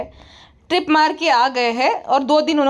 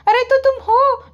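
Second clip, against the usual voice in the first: squeaky wife; 11 semitones higher than usual. Retold or imitated. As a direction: ask it in a high squeak. Wife mocking her husband.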